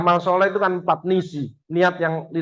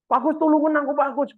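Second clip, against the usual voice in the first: pleading caller; 8 semitones higher, faster than usual. Retold or imitated. Caller begging for help.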